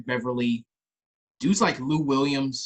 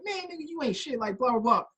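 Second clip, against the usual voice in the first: mocking squeaky trash talk; 8 semitones higher, 3 dB quieter than usual. Imitating small trash talker.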